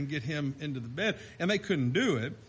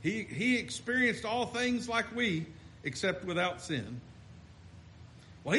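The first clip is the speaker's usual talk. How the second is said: ordinary talk, nothing marked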